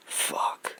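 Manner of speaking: breathless whispered swear; stunned whisper. Retold or imitated. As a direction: breathe it out in shock. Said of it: "he just looked at me and he went".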